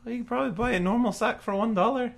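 high, quick merchant voice; shopkeeper. Acted. Shopkeeper offering a cheaper sack, high quick voice. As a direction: rattle it off in a high character voice.

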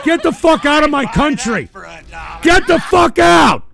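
furious, high, very loud yell; real furious shout. Real, not acted. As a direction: drive them out of the country with a roar. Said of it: "I'm pissed off".

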